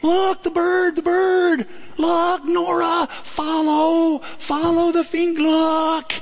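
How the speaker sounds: very high, slow coaxing voice; urgent pointing. Retold or imitated. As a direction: plead with the baby to follow the finger. Mother urging baby to look.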